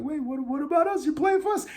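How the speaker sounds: high squeaky mocking voice; mock whiny players. Podcaster mocking rival players, high whiny voice. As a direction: whine it like confused teammates.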